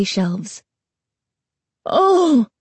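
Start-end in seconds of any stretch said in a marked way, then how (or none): 1.8-2.5 high, loud gasp of horror; startled cry. A narrator's reading. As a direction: jolt with sudden horror. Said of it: "a startled cry of horror".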